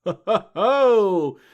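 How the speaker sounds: slow, loud, theatrical ho ho ho; booming mock laugh. Real, not acted. Cohost reveals Angel Island, answering with a loud slow mock laugh. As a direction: belt a villainous jolly laugh.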